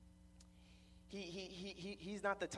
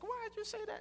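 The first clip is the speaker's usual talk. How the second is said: high-pitched mock indignant mom; mad mom. Mom confronting her husband after he yells, high mock-indignant voice. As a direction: put on a high voice, lightly scolding. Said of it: "she just gets mad".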